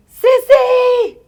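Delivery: high, loud imitated cry for help; mock-panicked high call; sister calling out. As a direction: call out high, stretching the name.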